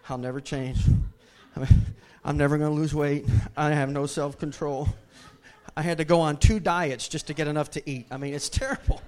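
hammed-up self-pitying complaint, loud; mock whiny dieter. Preacher mocks a dieter's loud self-pity. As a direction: play a loud moaning Twinkie eater. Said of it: "As you're sitting there eating".

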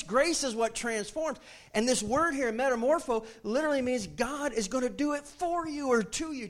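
high, loud fervent preaching; impassioned pulpit climax; preaching at full force. Real, not acted. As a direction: preach it out hard to the congregation.

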